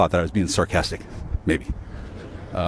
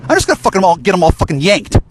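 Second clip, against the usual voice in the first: high, loud outburst; angry shout; 7 semitones higher, 4 dB louder than usual. Real, not acted. Ranting vlogger yells at his viewers, loud and high. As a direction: yell it out in a burst of rage.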